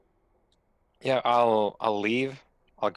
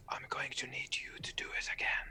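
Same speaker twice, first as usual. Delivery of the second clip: fast, cold whisper; 14 dB quieter, faster than usual. Acted. Visitor whispers a command to the old man, cold whisper. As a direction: whisper the order from the shadows. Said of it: "I'll whisper to him".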